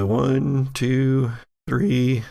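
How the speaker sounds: normal talking voice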